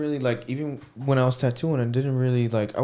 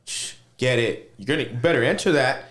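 hype; 2 semitones higher, 6 dB louder than usual. Retold or imitated.

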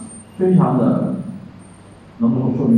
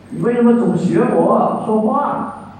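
put-on mildly angry child; 8 semitones higher, slower than usual. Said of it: "生气嘛".

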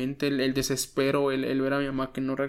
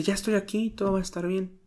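higher, softer soothing mother's voice; mother comforting; 4 semitones higher, 6 dB quieter than usual. Retold or imitated. Mother in a dream comforting her son, soft and soothing. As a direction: reassure gently, like a mother calming her child. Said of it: "mi mamá me dice".

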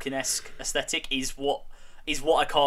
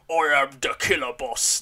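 orc boss boast; 5 dB louder than usual. Retold or imitated. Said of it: "like that one of going".

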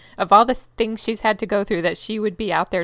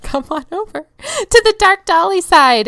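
spooky invitation; 7 semitones higher, 9 dB louder than usual. Acted.

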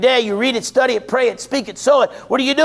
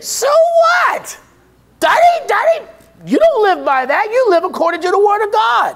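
preaching at full force; 9 semitones higher than usual. Acted. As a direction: throw the excuse back at the congregation. Preacher dismisses the pandemic excuse.

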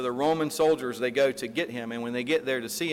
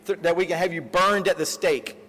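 higher and louder, a frustrated official threatening; angry yelling; 6 semitones higher, 4 dB louder than usual. Retold or imitated. Proconsul threatening Polycarp with burning, angry yell. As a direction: yell the threat, fed up and fussing. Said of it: "starts yelling at him".

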